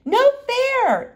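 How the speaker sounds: high, loud indignant cry; yell. Acted. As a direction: shout a protest like a kid. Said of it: "yelled Janet".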